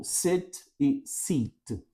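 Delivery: sit short, seat long; sit and seat, slow contrast. Shown as a demonstration. Teacher contrasts the vowels slowly. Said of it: "diferenciam por uma única vogal".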